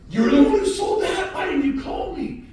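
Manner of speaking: high, loud amazed mock shout with a laugh; mock exclamation. Buyer at a fundraiser confronting the seller, loud mock exclamation. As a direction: act out the shocked buyer.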